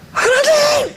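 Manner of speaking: high, ringing call of 하나님; calling out to God. Pretend. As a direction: cry out to heaven as if summoning. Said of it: "살아계시면 막 이래야 된다니까요".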